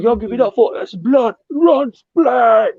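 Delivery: high, loud stage announcement; hyping the band. Retold or imitated. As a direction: whip up the crowd like an MC.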